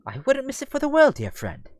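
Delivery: high-starting, courteous British reply; British Damien. Acted. Damien replying to dear friend Hugo, courteous British voice. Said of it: "I made them both British".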